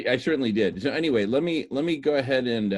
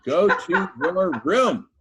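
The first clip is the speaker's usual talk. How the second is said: stern parent; 5 semitones higher, 4 dB louder, slower than usual. Pretend. Host sends group back to room.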